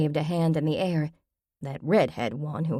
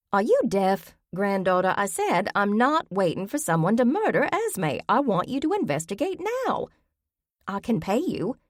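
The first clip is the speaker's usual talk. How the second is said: put-on higher, pushy old lady voice, rising in heat; impatient old grandmother; 4 semitones higher than usual. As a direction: demand it like a stubborn granny. Grammy voiced in the story.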